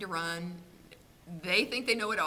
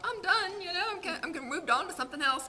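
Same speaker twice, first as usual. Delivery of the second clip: determined; 9 semitones higher than usual. Retold or imitated. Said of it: "I thought".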